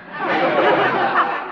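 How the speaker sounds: high flirty giggle; coy giggle.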